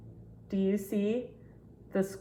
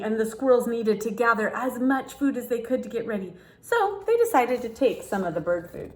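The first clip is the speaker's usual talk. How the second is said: put-on lively read-aloud for children; storybook reading; 3 semitones higher, 4 dB louder than usual. Reading a picture book to kids, storytelling voice.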